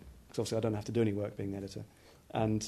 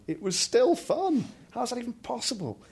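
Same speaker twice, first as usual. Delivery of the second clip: amazed; 12 semitones higher, 6 dB louder than usual. Real, not acted. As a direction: marvel at it, pitch soaring. Amazed at how fun it is.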